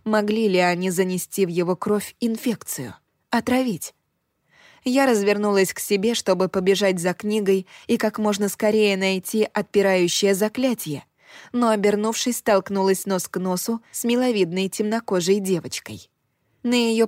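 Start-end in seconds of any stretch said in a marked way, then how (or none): none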